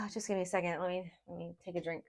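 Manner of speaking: barely voiced, needing a moment to recover; real quiet effort to hold back tears; choked up. Real, not acted. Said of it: "want to break down and cry".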